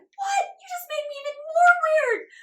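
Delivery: very high, loud cry-laugh shout; laughing mock outrage. Mock outrage at becoming channeler.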